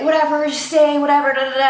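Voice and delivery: imitating a pushy boyfriend's whatever; dismissive boyfriend. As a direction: wave her worries away, bored. Voicing boyfriend who wants her stay.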